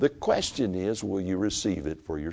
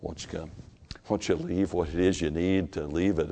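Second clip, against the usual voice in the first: ordinary talk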